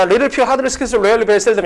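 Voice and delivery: memorised list, reeled off without pauses; quick rote chant of English words; rapid-fire recitation. Shown as a demonstration. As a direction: rattle the word list off in one run. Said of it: "그냥 버버버 하면 돼".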